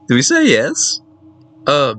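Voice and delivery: ordinary talk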